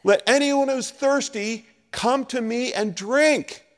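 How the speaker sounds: slow, high, ringing call to the crowd; loud proclamation. Retold or imitated. Jesus calling out to the festival crowd, slow and ringing. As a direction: proclaim it slowly, as if to a whole festival. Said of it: "said in a loud voice".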